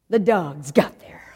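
slow hushed whisper; suspense whisper. A narrator's reading. Suspenseful rescue moment.

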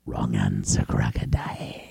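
very deep, soft whispered lion; quiet low menacing whisper; lion's verdict. Acted. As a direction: hush it low like a death sentence.

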